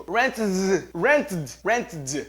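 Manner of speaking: "rented" choked to "rentd" with no E; mock rented without E. Shown as a demonstration. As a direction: stutter "rented" like a seizure. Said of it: "falar o rent, o seu passado, e ignorar o E". ("rented" sem o E, engasgado)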